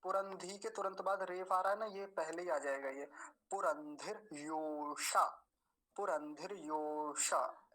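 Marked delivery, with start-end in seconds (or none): 3.5-7.7 slow पुरंधिर योषा, र closing धिर; model pronunciation. Shown as a demonstration. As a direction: join र onto धि, then draw out योषा. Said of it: "ऐसे बोलेंगे".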